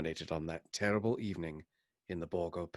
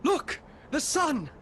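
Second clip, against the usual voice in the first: high, loud, slow shout; excited shout; 7 dB louder, slower than usual. Acted. Harker shouting to the others as the sun sinks, shout. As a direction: shout it to everyone.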